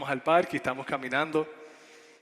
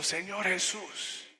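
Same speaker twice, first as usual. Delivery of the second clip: higher, soft, drawn-out prayer; hombre orando; 6 semitones higher, 5 dB quieter, much slower than usual. Retold or imitated. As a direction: slowly pray as the man, softly. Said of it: "cerró sus ojos y empezó". (oración imitada, suave y lenta)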